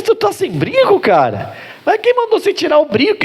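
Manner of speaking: high astonished questions; shocked surprise. Retold or imitated. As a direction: gasp it out like you can't believe it. Pastor retells his own shock.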